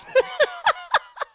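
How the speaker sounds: high, loud squealing laugh; shrieking laugh. Real, not acted. Streamer laughing at a rude chat message, shrieking high. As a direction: burst into a shrill squeal of laughter.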